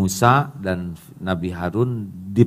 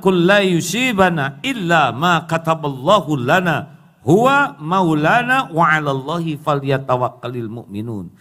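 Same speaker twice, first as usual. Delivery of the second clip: chanted Arabic verse; Quran recitation; 5 semitones higher, 4 dB louder than usual. Acted. Preacher reciting a verse to the congregation, chanted. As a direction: intone it like a recitation.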